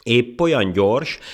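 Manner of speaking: high, loud, slow jeer; derisive. Acted. Monkey tickled by the ant's boast, jeering. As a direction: draw out the taunting question. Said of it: "a hasát fogta nevettében".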